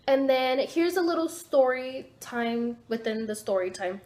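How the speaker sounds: ordinary talk, nothing marked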